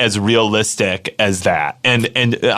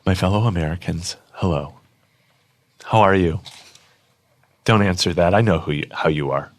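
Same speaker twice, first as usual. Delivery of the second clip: a hint of solemn speech, lower and softer; mock presidential address; 6 semitones lower, 4 dB quieter than usual. Guest reads Uncle Sam's note as a solemn address. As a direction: read it like a grave address to the nation. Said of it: "I'm gonna read it".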